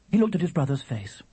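plain reading voice

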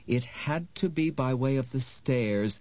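usual speaking voice

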